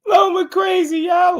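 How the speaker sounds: high cracking laugh-shout; laughing shriek. Real, not acted. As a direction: squeal it through laughter. Cracking up at own joke.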